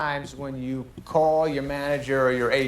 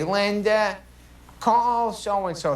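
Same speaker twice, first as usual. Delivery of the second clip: a higher, slowed call to his assistant, lightly voiced; calling out to the assistant in a drawn-out, slightly higher voice; imitating the manager; 7 semitones higher, much slower than usual. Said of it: "and I hear him say".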